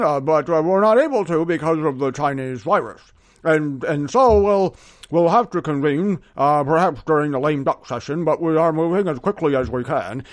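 put-on voice of a stalling senator; McConnell. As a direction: play a politician making excuses, haltingly. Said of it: "for McConnell and the Republicans to say".

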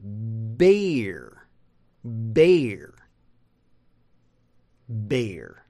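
"bear" drawn out as bay-er; low, isolated American "bear"; long A sound. Shown as a demonstration. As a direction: stretch the long A in "bear", then a full R. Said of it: "it should be a long A sound".

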